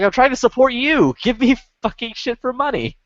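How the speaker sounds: high-pitched retold plea, pushed in exasperation; exasperated protest. Retold exasperated argument with gun shop.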